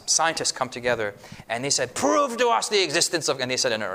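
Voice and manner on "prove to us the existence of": mock Russian accent, pitched higher. Russian scientists challenging a visiting preacher, Russian accent. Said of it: "in a Russian accent".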